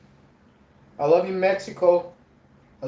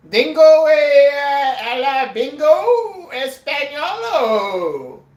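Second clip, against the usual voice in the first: loud, high and drawn out; hammed-up Spanish gibberish at full volume; clowning mock Spanish; 7 semitones higher, 7 dB louder, much slower than usual. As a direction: belt out silly fake Spanish, stretching the endings.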